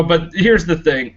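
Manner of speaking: ordinary talk, nothing marked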